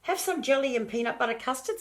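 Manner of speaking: high grandma voice. Acted.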